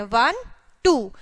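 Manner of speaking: ordinary talk, nothing marked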